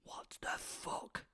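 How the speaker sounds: silent whispered what the fuck, slow; slow unvoiced mouthing; mouthed.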